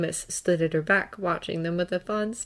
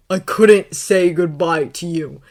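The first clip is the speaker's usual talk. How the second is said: slow, loud, emphatic protest; desperate shout; 9 dB louder, slower than usual. A narrator's reading. Harry answering Draco through tears, desperate shout. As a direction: force each word out loudly. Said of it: "Harry shouted".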